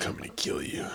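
unvoiced menacing whisper; creepy whisper. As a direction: whisper a horror threat. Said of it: "that's my deep, uh, sick voice".

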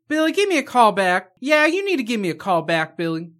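loud, high, insistent mimicry; imitated phone conversation. Mimicking the actress talking to Billy, as a loud conversation. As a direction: act as if answering someone on the line. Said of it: "like she was having a conversation".

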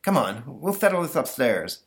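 put-on high, flustered little boy. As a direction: stammer like a worried kid. Stanley protests.

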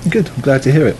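distant, off-mic voice; leaning back away from the mic; far from mic. Real, not acted. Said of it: "you're sitting really back".

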